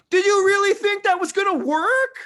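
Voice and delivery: very high loud anime squeal; anime reactor voice. Retold or imitated. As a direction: shriek it like an anime sidekick. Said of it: "a third person reacting, being like".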